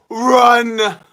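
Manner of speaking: loud, high, drawn-out yell; shouted command. Pretend. Streamer calls out to his chat, high shout. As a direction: yell it out like a warning.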